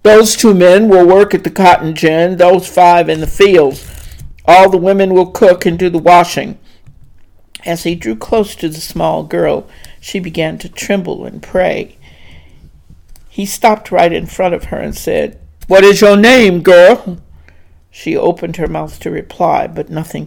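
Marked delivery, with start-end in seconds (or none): 0.0-6.6 loud clipped orders, a hint of rumble; clipped rumbling voice. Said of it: "clipped instructions in a deep rumbling voice". 15.7-17.2 loud, hard question; loud demand. Acted. Master questions the girl.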